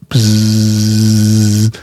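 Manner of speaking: long low drawn-out buzzing noise; wasp buzz. Retold or imitated. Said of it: "Es machte".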